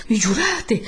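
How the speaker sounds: high, loud plea; urgent pleading. Acted. Don Abbondio begging Renzo to swear, urgent pleading. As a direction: press anxiously for a promise. Priest begs for an oath.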